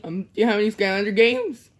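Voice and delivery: quick, low put-on kid voice. A kid asking his new dad for games, put-on voice. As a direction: rattle it off like a bored kid.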